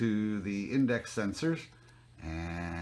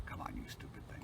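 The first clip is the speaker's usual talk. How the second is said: frustrated whisper; 12 dB quieter, faster than usual. Real, not acted. Telescope won't connect, frustration.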